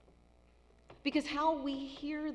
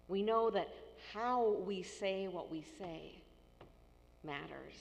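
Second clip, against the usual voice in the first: ordinary delivery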